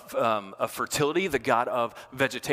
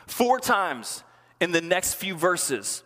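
ordinary talk, nothing marked

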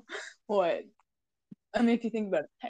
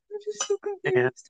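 giggling and confused; laughing; 10 semitones higher than usual. Real, not acted. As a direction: say it mid-giggle.

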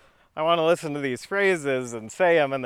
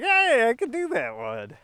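eager brag; 10 semitones higher, 4 dB louder than usual. Real, not acted. Excited boast.

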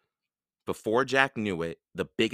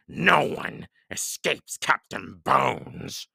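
slow, menacing pirate roar; roaring Captain Bones; 5 semitones higher, 4 dB louder, slower than usual. A narrator's reading. Captain Bones holds Jack and Annie captive, roaring. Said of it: "he roared".

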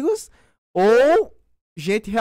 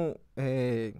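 losing the thread, drifting quieter; trailing off; 9 semitones lower, 10 dB quieter than usual. Real, not acted. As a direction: voice fading as the thought is lost. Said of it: "já esqueceu".

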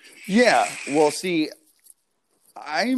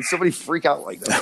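normal talking voice

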